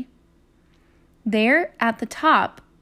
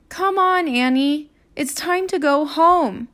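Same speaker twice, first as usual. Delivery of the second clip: raised, slightly impatient big-brother call; 5 semitones higher, 3 dB louder than usual. Acted. Jack calls Annie home from the road, raised and impatient. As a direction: call her back before dark.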